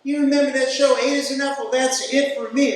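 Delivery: grateful patient. Retold or imitated. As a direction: gush thanks like the relieved mother. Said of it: "She sat up and said".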